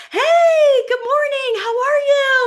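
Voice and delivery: very high, loud, sing-song hello; over-the-top cheerful phone greeting; bubbly high greeting. Retold or imitated. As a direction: greet them like a burst of sunshine. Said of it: "they're like".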